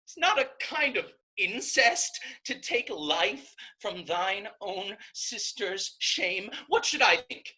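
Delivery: high, loud, shaking with fury; a sister's scalding contempt, shouted high; furious outrage. Acted. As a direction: tear into him, disgusted. Isabel rages at pleading brother.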